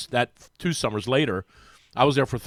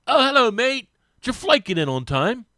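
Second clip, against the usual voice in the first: higher, louder mock English accent on the greeting; 8 semitones higher, 5 dB louder than usual. Teammate greeting him after practice in a mock English accent. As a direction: ham up a chirpy English footballer. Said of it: "but it was his English".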